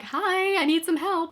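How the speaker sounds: very high, light mock plea for help; high-pitched plea. Asking the Facebook group for help, in a high voice. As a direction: imitate yourself asking sweetly high for help. Acted posting questions to a group.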